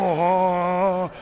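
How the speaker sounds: loud, long moaning "oh"; mock zombie moan. All the tombs opening at once, mock zombie moan. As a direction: groan like the risen dead, hammed-up. Imitates the dead rising from tombs.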